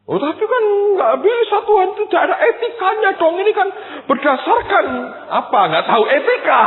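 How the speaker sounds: high, loud indignant whine at God; indignant protest. Retold or imitated. Preacher complaining to God, high, loud and indignant. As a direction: protest hotly as if wronged.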